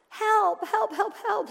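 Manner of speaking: high, loud panicked cries, acted; crying out for help. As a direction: yelp rapidly for help as if drowning. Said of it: "how quickly we cry out".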